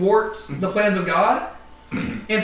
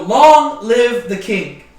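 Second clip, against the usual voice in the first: slow, louder cheer, "long" stretched out; mock royal acclamation; 3 semitones higher, 7 dB louder, much slower than usual. Teacher voicing the crowd hailing the king, slow loud cheer.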